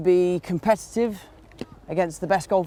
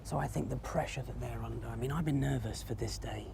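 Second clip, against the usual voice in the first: quiet, hushed golf-course voice; hushed tones; 3 semitones lower, 12 dB quieter than usual. Real, not acted. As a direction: keep it down so the golfer can play. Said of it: "speaking in the hushed tones".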